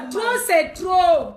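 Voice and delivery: loud, slow, drawn-out protest; emphatic outcry. Real, not acted. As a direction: belt it out slowly as a slogan. Repeats slogan, first emphatic take. (cri de protestation fort et traînant)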